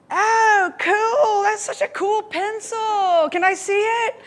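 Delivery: put-on high, eager kid's voice; squeaky thrilled boy admiring a pencil; excited kid voice. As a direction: play the excited kid wanting a look. Kid voiced in retold story.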